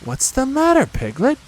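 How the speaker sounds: put-on high, concerned child's voice; Christopher Robin. A narrator's reading. Boy just getting up asks Piglet, high concerned voice. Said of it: "said Christopher Robin".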